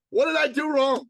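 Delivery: high, loud panicked man; hammed-up alarm at being blamed; mock panic. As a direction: panic comically, pitch and volume jumping up. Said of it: "we panic".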